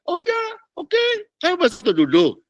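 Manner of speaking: high, sheepish voice of a guilty believer; guilt-ridden believer. Acted. (jemaat yang merasa tertuduh, suara tinggi)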